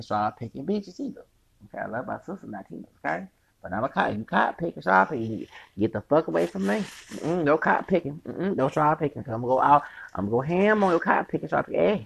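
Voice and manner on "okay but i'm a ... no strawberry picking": normal talking voice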